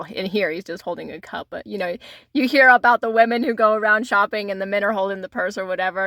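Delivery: ordinary delivery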